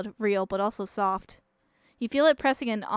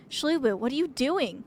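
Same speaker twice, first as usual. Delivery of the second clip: ordinary talk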